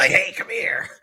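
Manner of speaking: high, laughing unvoiced whisper; creepy alien whisper. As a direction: whisper like a lurking creature. Voicing the alien sneaking up.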